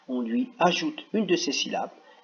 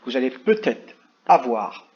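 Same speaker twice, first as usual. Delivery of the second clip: ordinary talk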